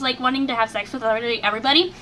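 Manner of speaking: ordinary talk, nothing marked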